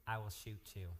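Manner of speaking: deadpan mock threat in Walter's voice. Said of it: "and he talked like this".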